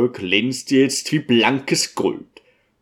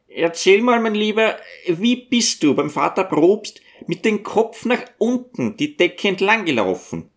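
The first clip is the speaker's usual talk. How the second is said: high-pitched, coaxing storybook questioner; curious cleric in a high character voice; coaxing Zachari; 8 semitones higher than usual. Acted. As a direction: raise the voice high and coax out the tale.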